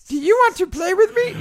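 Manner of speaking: high squeaky toy voice; talking doll. Retold or imitated. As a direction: squeak like a tiny toy robot. Voicing Wheelie toy robot.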